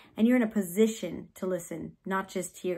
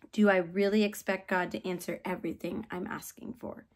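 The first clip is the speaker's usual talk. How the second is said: normal talking voice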